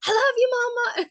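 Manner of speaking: very high, loud little-kid imitation; toddler calling loudly. Toddler calls to mom in the kitchen, high loud call. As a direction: holler it like a toddler from bed. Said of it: "he just kept saying it louder and louder".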